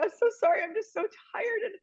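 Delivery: very high, thin mock weeping voice; retold crying. Exhausted caller pouring out her heart to a stranger, mock sobbing. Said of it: "I just start crying".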